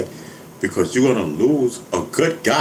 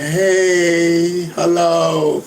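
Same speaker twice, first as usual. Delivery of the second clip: a hint of mock nerves, higher and a bit louder; nervous greeting; 6 semitones higher, 4 dB louder, much slower than usual. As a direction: play it lightly as a shy, jittery hello. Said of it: "come out all nervous".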